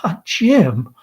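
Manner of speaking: ordinary talk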